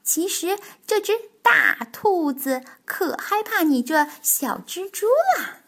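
high-pitched playful mockery; teasing. Tiaotiao makes fun of Bengbeng to the spider, teasing. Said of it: "跳跳取笑蹦蹦说".

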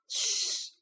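sharp unvoiced shush; a breathy warning hiss; hushing shh. A narrator's reading. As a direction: hush him fast, breath only. Hushing his friend in the graveyard.

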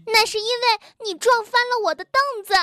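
high, fast quarrelling yell; angry yell. A narrator's reading. Bengbeng blames Tiaotiao for the toppled stool, yelling. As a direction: yell the accusation back quickly. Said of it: "蹦蹦吼道".

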